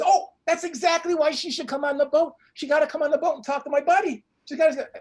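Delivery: high-pitched, insistent enthusiasm; eager persuading. Retold or imitated. Friend persuades the ladies to board his boat, eager persuading. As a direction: jump in and urge them aboard. Acted friend urges them aboard.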